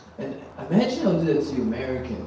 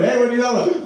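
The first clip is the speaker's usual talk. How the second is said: higher and louder, half-laughing protest; mock indignant; 10 semitones higher, 6 dB louder, much faster than usual. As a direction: brush off the accusation.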